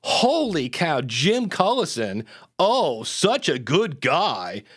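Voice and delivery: slow, heavily stretched sarcasm; drawn-out mock praise with swooping pitch; sarcastic. Shown as a demonstration. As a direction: lay the fake admiration on thick. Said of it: "wanted it to be sarcastic".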